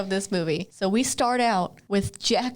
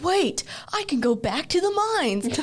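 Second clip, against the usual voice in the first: higher-pitched mock realization; excited movie quote; 6 semitones higher than usual. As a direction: act out a character's sudden bright idea. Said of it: "he's like".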